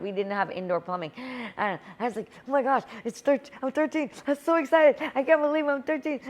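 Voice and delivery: normal talking voice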